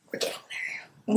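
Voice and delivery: tiny teasing whisper. Real, not acted. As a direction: breathe it to yourself as a tease. Teasing aside, then repeated aloud.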